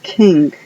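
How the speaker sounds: King with a sounded K, as in kilo; crisp K in King; English word king. Shown as a demonstration. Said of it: "la K de kilo suena". ("King" con K como en kilo)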